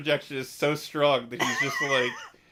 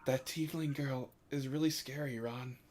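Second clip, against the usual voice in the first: hushed but voiced and audible; a softened voice meant to be overheard; stage whisper; 2 semitones higher, 6 dB quieter than usual. Shown as a demonstration. Player repeating the tiefling remark for Vox, stage whisper. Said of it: "sorry, I'll stage whisper".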